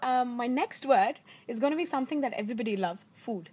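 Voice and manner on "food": ordinary delivery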